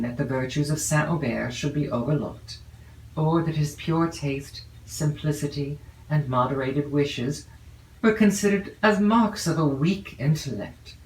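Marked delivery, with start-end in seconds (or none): none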